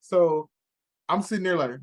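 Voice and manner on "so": normal talking voice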